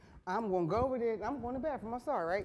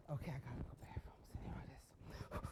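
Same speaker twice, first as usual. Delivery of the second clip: whisper; 7 semitones lower, 16 dB quieter than usual. Retold or imitated.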